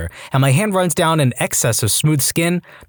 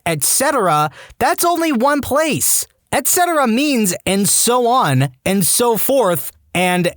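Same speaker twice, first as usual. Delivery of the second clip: higher-pitched, drawn-out indignant rant; exasperated rant; 7 semitones higher, slower than usual. Acted. Narrator breaks off to rant about a grammar mistake, exasperated. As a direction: rant with heavy emphasis, stretching the key words. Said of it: "I am ranting about this right now".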